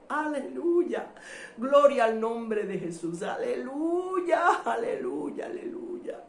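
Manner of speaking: crying out hallelujahs, long and drawn out; real overcome worship, voice breaking; tearful exultant praise. Real, not acted.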